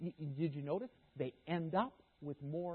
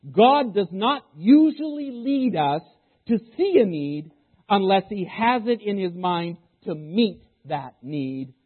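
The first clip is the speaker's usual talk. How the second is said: emphatic quote reading; 3 semitones higher, 14 dB louder, slower than usual. Shown as a demonstration. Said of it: "I'll say that again".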